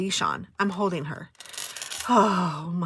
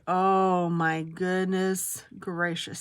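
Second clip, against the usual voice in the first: slow drawn-out exclamation; much slower than usual. Real, not acted. To her viewers, a slow drawn-out "Oh my goodness gracious". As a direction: stretch out "Oh my goodness gracious" very slowly, every word long. Exclaiming before telling a story.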